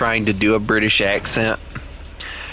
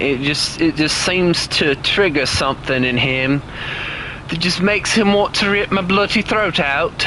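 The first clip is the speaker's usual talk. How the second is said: playful put-on British accent, pitched up; 5 semitones higher than usual. Streamer complaining about friend's reaction, in a mock British accent. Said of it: "how much do you hate my British accent".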